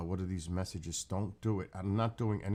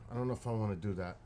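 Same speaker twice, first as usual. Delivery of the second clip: normal talking voice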